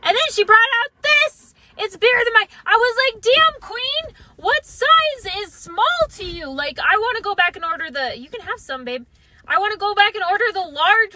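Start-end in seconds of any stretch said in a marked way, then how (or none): 3.2-6.5 amazed. Retold or imitated. Amazed at huge cone.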